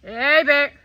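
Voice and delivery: firm shout to make it leave; scaring off a bear. Real, not acted.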